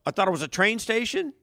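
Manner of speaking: mock clueless excuse. Imitating a clueless traveler.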